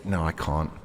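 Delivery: ordinary delivery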